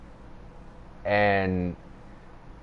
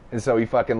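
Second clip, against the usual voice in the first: ordinary talk, nothing marked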